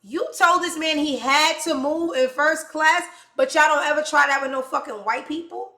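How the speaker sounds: loud, indignant outburst; loud angry rant; furious shout. Real, not acted. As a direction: shout the accusation in outrage. Outraged at first-class treatment.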